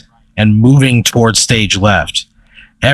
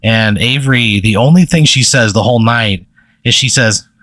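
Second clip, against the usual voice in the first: ordinary delivery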